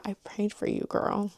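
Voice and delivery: quiet sincere line to a future friend; soft tender quote. Retold or imitated. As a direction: say it like a secret blessing to a friend. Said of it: "I was like".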